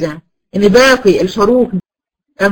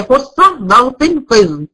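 slow dictation; 2 semitones higher, much slower than usual. Shown as a demonstration. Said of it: "صفة ملازمة للحروف اللي أنا قلت لك عليها".

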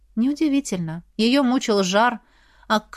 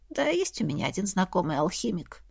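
softer, languid and flirtatious; 7 dB quieter than usual. A narrator's reading. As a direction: purr it, lazily flirting. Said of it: "томно произнесла Софья".

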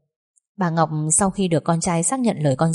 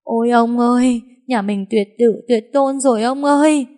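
high-pitched acted wail; tearful wailing; 6 semitones higher, 4 dB louder than usual. A narrator's reading. Wife wailing to her husband about the family line. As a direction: wail it out as if blubbering. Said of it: "mếu máo khóc to".

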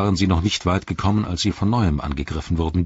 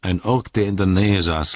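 ordinary delivery

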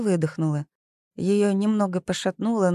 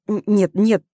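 louder stammered, startled denial; flaring up; 3 semitones higher, 7 dB louder than usual. A narrator's reading. Zoya flares up at August's insinuation, indignant outburst. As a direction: blurt out an indignant refusal, stumbling on the first word. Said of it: "вспыхнула она и вскочила со стула".

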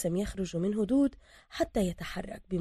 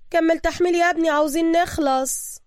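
higher, louder female boss; boss woman's voice; 9 semitones higher, 6 dB louder than usual. Acted. Boss woman ordering Bruce back to loading, commanding. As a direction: order him briskly.

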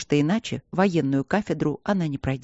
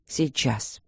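soft, soothing single word; успокаивающе; 3 semitones lower, 5 dB quieter than usual. A narrator's reading. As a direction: reassure gently, keeping the voice down. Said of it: "успокаивающе сказал ректор". (мягко, тихо успокаивая)

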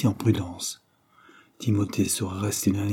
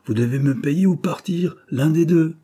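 firm, raised landlord voice, building louder; harsh demand; 7 semitones higher, 5 dB louder than usual. A narrator's reading. Landlord to tenant behind on rent, harsh. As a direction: press the ultimatum hard, higher at the end. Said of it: "répliqua durement monsieur Abbott".

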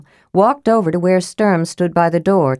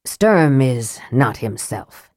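dry; 5 semitones lower, slower than usual. Acted. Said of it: "said Raistlin dryly".

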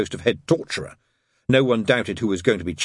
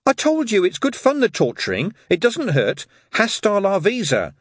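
high, hurried reassurance; quick, insistent persuading; added quickly; 11 semitones higher, 4 dB louder than usual. A narrator's reading. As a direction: add it quickly, pitched up. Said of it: "she added quickly".

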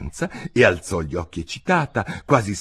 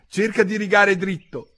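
high, indignant order; offended, high-pitched scolding; cross warning; 11 semitones higher than usual. A narrator's reading. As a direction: warn her off in a huff. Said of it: "disse il topo balzando in piedi e allontanandosi".

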